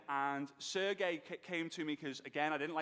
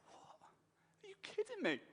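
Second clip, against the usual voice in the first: stunned disbelief; 12 semitones higher, 7 dB quieter than usual. Retold or imitated. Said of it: "I was like".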